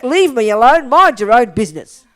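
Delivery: playful indignant leave-me-alone; mock annoyed. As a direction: push the helper away, half joking. Fends off well-meaning Shuki.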